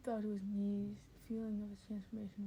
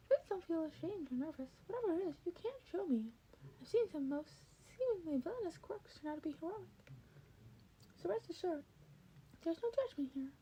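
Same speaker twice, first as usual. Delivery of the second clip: high, quick soothing character voice; high-pitched kindly encouragement; reassuring Nezu; 8 semitones higher, faster than usual. Acted. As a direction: coax him gently, upbeat. Nezu reassures nervous Denny.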